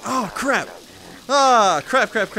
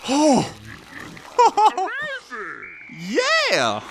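higher, louder burst of excitement; excited oh; 5 semitones higher, 3 dB louder than usual. Real, not acted. Gamer winning the level, excited cry. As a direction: let out a thrilled cry. Narrow win in the game.

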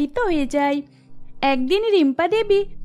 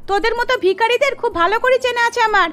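higher and louder, sneering at the maid; contemptuous scolding; 4 semitones higher, 3 dB louder than usual. Acted. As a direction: tell the servant off like a beggar. Mistress refuses the maid's request.

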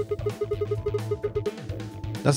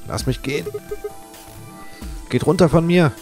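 panicked pleading; 9 semitones higher than usual. Acted. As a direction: struggle and beg to be released. Pinned down by the others.

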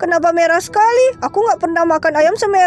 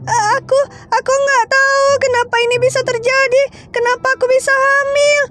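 high, startled sister in pain; a frightened girl whose belly suddenly hurts; panicked older sister; 7 semitones higher than usual. Acted. Sister figure again. (kakak perempuan panik bersuara tinggi)

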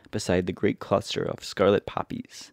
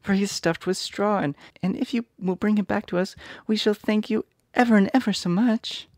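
put-on high little girl voice; worried girl asking; 13 semitones higher than usual. A narrator's reading. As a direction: plead as young Dorothy. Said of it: "said Dorothy eagerly".

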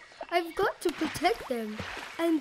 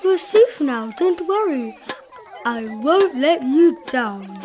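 louder, reassuring elephant; calling back; 2 semitones higher, 7 dB louder than usual. A narrator's reading. Elephant promising the mayor safety, calling back reassuringly. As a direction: promise it firmly to someone tiny. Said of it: "Horton called back".

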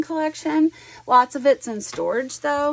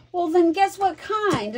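higher, drawn-out mock disbelief; imitating taken aback; 8 semitones higher than usual. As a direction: play the stunned husband, slow and high. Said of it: "his mouth fell open".

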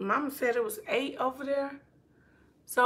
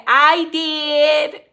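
quoted viewer; 4 semitones higher, 14 dB louder than usual. Retold or imitated. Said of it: "Ocean T V said".

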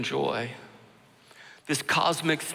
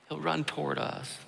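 soft echo; 7 dB quieter than usual. Real, not acted. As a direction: let it fall to a hushed repeat. Repeated line, softer echo.

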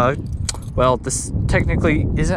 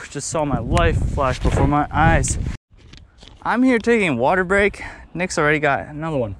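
ordinary talk

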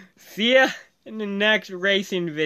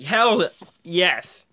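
ordinary delivery

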